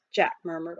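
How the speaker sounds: ordinary delivery, nothing marked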